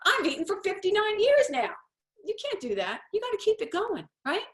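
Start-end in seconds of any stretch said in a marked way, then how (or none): 0.1-1.7 much higher and louder, an indignant whining voice; mock the baffled complainer, high and loud; mock whiny complainer. Mocking people who stop eating and blame their body, high indignant whine. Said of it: "get mad at my body and say".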